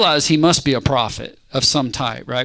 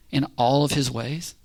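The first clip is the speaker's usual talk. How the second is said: normal talking voice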